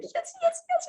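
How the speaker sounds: high, gleeful yeses; squealing yes yes yes; excited yes. Shown as a demonstration. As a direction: cheer it out in delight.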